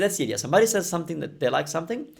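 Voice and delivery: normal talking voice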